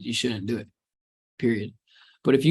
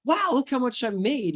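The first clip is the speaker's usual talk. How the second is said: high-pitched wowed outburst, at usual volume; excited gloating; 11 semitones higher than usual. Acted. Coach acting out someone hooked on results, excited. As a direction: act out a thrilled reaction to your own results. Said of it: "you can get excited".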